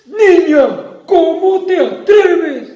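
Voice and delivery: reclamo agudo y fuerte; regaña a la niña indignado; monstruo enojado. Acted. (monstruo furioso regaña a la niña)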